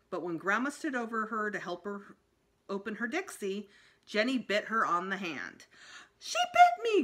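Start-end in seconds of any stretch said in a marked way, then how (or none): 6.2-7.0 very high, loud yelp of pain; shocked cry. A narrator's reading. Grandma yelping after the child bites her hand, high and loud. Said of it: "Grandma cried".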